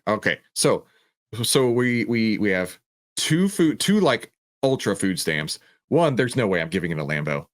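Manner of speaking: normal talking voice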